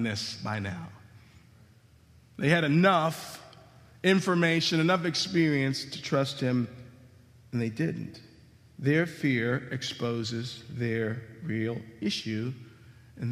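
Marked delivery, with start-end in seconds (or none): none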